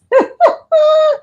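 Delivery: shrill burst of laughter; high squeal laugh. Real, not acted. Vlogger reacting to a chat comment, high squealing laugh. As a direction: squeal with delight.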